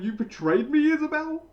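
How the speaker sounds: high, loud, hurt cry of betrayal; a villain realising his ally turned on him; betrayed disbelief. Retold or imitated. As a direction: throw the question out in stunned hurt. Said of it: "And Pierrot is just going".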